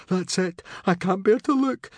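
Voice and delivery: high, distressed cry; high-pitched, anguished despair; can't bear to look. Acted. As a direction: squeal it, dreading what comes next. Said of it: "said Fishlegs, shutting his eyes".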